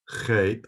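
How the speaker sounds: Chet with a very harsh CH from the throat. Shown as a demonstration. Teacher demonstrating the Hebrew letter, harsh throaty Chet. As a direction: rasp the first sound of Chet in the throat. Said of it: "a very harsh C H sound".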